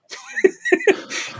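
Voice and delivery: sudden high giggling laugh; high burst of laughter. Real, not acted. Telling a podcast host the story, a high burst of laughter. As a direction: laugh out at the absurd memory.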